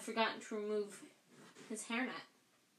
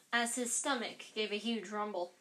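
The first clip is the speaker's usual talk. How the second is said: usual speaking voice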